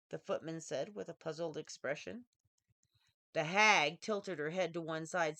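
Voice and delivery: ordinary delivery, nothing marked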